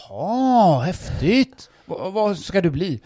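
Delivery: loud, high fake interest; hammed-up fake excitement; mock enthusiasm. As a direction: pretend to be thrilled.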